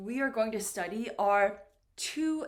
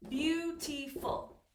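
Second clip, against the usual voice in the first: slow "beautiful" in three beats, higher pitched; syllable by syllable; 4 semitones higher, slower than usual. Shown as a demonstration. Teacher counting syllables for learners, "beautiful" slowly in three beats. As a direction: sound out "beautiful" slowly, beat by beat. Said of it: "three syllables here".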